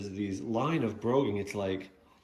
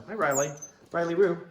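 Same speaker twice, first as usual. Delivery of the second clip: higher, louder pet greeting; cooing at the dog; 7 semitones higher, 5 dB louder than usual. Real, not acted. Owner greeting his dog Riley on camera, higher and louder. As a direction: call the dog sweetly by name.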